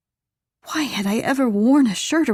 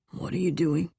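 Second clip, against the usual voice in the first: male lover's voice. A narrator's reading. He stops her from moving off his lap, hushed male voice. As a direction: question her in a hushed man's voice.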